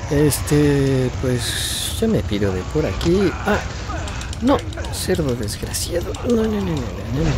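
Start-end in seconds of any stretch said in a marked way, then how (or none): 3.4-7.3 higher, frantic no no no; panicking gamer. Real, not acted. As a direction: panic as the pig attacks. Lo ataca un enemigo. (jugador en pánico)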